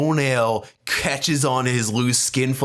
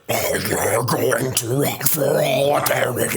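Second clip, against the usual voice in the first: slow, phlegmy demon growl; hammed-up rotting daemon; gross Nurgle voice; slower than usual. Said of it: "the narrator doing the gross Nurgle voices".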